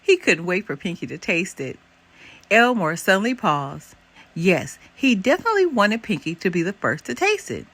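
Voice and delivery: plain reading voice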